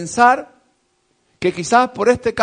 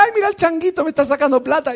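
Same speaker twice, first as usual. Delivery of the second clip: high, gushing "ay, mira" voice; delighted onlooker; 8 semitones higher, faster than usual. Retold or imitated. Preacher voicing a charmed onlooker, high and gushing. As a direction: coo like a charmed bystander. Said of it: "decía".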